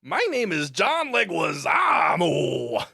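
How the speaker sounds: slow, loud, shouted announcement of his name; hammed-up proud shout; John Leguizamo impression. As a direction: bellow it out, drawn out. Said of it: "John Leguizamo kinda talks like this".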